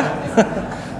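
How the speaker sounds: quick voiceless chuckle; airy laugh. Real, not acted. Preacher chuckling at the crying man eating. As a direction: laugh under the breath.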